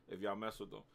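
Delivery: normal talking voice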